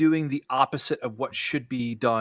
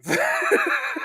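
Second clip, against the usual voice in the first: high laugh. Real, not acted. Laugh after quote.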